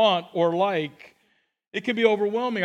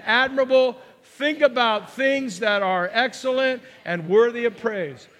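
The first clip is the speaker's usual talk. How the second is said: slow, higher-pitched group reading aloud; best sermon voice; 4 semitones higher than usual. Pretend. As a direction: read it out slowly for all to follow. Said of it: "read with your best eleven twenty-four sermon, seminar voice".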